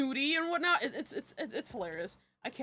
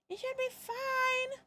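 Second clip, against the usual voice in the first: mock reassurance; 12 semitones higher, 4 dB quieter, slower than usual. Self-reassuring joke repeat.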